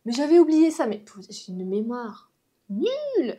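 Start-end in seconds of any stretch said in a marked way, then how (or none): 0.1-0.9 acting out surprise, higher and louder; mock-amazed outburst; retold astonishment. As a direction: replay your amazed reaction at having forgotten. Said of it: "je suis toujours étonnée à chaque fois".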